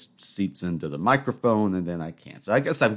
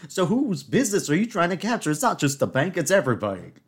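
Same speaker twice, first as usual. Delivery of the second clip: exasperated rant; 7 semitones higher, 3 dB louder than usual. Real, not acted. Ranting about bank hours.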